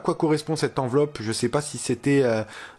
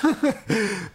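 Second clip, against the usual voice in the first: high little chuckle; brief burst of amusement; amused laugh; 6 semitones higher than usual. Real, not acted. Host after thanking [name], amused laugh.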